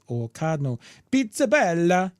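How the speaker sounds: slow, hammed-up Italian lilt on the name; mock Italian accent. Reading a viewer's super chat aloud, slow mock Italian accent. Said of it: "A Pizzabella sounds like".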